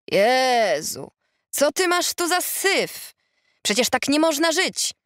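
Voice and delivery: high, drawn-out exclamation of disbelief; calling out. A narrator's reading. Retired policeman exclaiming from a friend's doorway, calling out. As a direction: exclaim in dismay, pitched well up. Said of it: "zawołał od progu Edzio".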